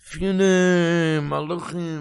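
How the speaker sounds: high, loud, very slowly stretched words; drawn-out dramatic retelling. Retold or imitated. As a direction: stretch it out like an awed proclamation. Dead friend recounts the angels.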